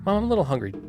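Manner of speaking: daughter asking mom. Retold or imitated.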